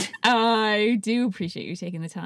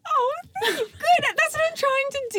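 high voice cracking with laughter; laughing high; 4 dB louder, faster than usual. Real, not acted. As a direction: talk through giggles, high.

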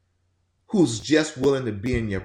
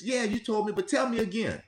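higher, lightly voiced willing listener; patient invitation; 7 semitones higher, 4 dB quieter than usual. Shown as a demonstration. Listener to grieving parent retelling a story, patient and willing. Models patient reply to mourner.

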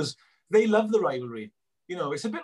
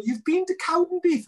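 high, louder incredulous squeak on "You've been to Cowdenbeath?"; mock disbelief; 4 dB louder than usual. Scotsman doubting a Welshman went to Cowdenbeath, high incredulous. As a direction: play the Scot who can't believe it.

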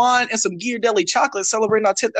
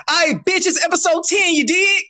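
hype intro; 9 semitones higher than usual. Real, not acted. Hyped show intro.

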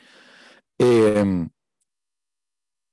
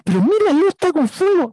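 imitando al inglés alarmado; 14 semitones higher than usual. Retold or imitated. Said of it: "me dicen".

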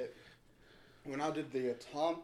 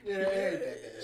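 hiccup giggles; 5 semitones higher, 11 dB louder than usual. Real, not acted. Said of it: "my guy has the hiccups".

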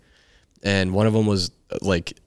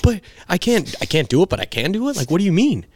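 high, loud exasperated outburst; pissed off; 11 semitones higher, 5 dB louder than usual. Retold or imitated. Player retelling his blowup at the coach, pissed and incredulous. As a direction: protest at full tilt, voice pitched way up. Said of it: "you can tell I'm pissed".

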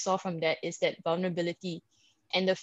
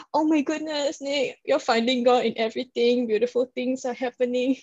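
excited gasp; 5 semitones higher than usual. Retold or imitated. Excited self-quote about show.

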